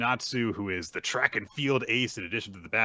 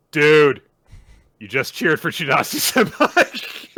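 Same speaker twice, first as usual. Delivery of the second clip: loud, higher teasing jab; teasing friend; 6 semitones higher, 11 dB louder than usual. Retold or imitated. Kyo teasing his friend about cheering, loud higher ribbing. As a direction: rib him for cheering.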